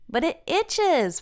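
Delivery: high, slow whine; Francine whining. A narrator's reading. Francine complaining to Olivia about the bite, high slow whine. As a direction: drag it out, itchy and fed up. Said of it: "Francine says".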